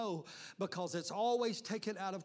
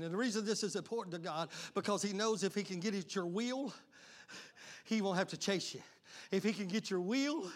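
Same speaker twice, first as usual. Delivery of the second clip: normal talking voice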